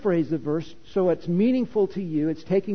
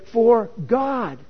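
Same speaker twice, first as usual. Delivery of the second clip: "God" hit louder and higher; stress on God; 4 semitones higher, 4 dB louder than usual. Shown as a demonstration. Preacher showing the congregation, stressing "God". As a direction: lean on "God" and let it ring. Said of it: "I'll just emphasize God".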